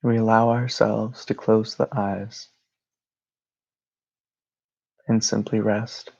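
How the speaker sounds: calm, even meditation voice with long pauses; guided meditation. Real, not acted. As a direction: guide them gently into rest, phrase by phrase.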